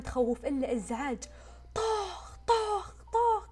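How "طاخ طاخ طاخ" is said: sharp high bangs, the last ones slow and drawn out; imitating the clanging pots; imitated banging. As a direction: bang it out like pots being struck. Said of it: "كذا إزعاج".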